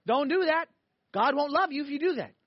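acted stern warning to a child; scolding parent. Parent warning repeated, more forceful.

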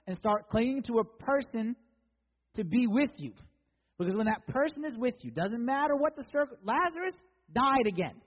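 high, loud sermon delivery; emphatic preacher in full flow; impassioned preaching. Acted. Preaches at raised force.

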